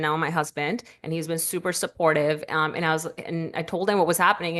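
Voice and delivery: ordinary delivery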